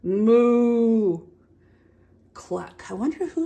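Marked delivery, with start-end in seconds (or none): 0.0-1.2 long, swelling moo; cow's moo. Acted. Reader answers what the cow says, long moo. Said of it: "What's a cow say?". 2.3-2.9 low, soft cluck; hen's cluck. Acted. Reader hints at the hen's sound, soft cluck. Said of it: "Hen said cluck".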